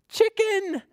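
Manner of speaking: very high-pitched happy announcement; spring the surprise on little kids; excited reveal. Pretend. Storyteller revealing the animal to children, excited reveal.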